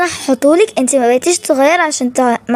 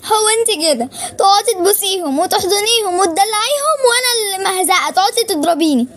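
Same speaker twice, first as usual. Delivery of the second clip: crying; 4 semitones higher than usual. Acted. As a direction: sob it out, hurt and accusing. Daughter crying after being hit.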